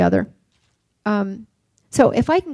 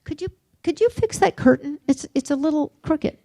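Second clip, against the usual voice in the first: higher mock mother voice, fussing about the curtain; imitating mom's distracted nagging; 6 semitones higher than usual. Son imitating his mother nagging about a curtain, higher mock fuss. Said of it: "she would say".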